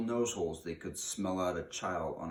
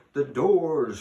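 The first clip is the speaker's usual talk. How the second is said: loud, harsh shout of the Grand High Witch; witch's shout; 8 semitones higher, 13 dB louder than usual. A narrator's reading. Grand High Witch commanding her assembled witches, booming shout. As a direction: bellow it so it fills the room. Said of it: "shouted the Grand High Witch in a voice that filled the room".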